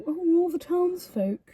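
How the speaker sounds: high, lightly put-on grand voice; a light parody of a stagey announcer; mocking townsfolk voice. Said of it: "You went very, like".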